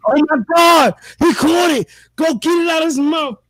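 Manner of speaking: high, panicked yelling wife. Retold or imitated. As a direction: shriek it like the frightened wife. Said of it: "She like".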